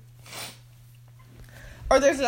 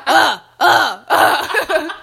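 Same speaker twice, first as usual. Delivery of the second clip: put-on loud strained baby grunts; retelling how she grunted in her crib as a baby; baby grunting; 5 semitones higher, 10 dB louder, much slower than usual. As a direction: grunt hard, like a baby hauling itself up. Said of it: "and I would be like".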